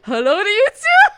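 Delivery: high, loud, sarcastic sweetness; fake-polite clapback in falsetto; mock-sweet sarcasm; sassy reply. Sassy comeback voiced.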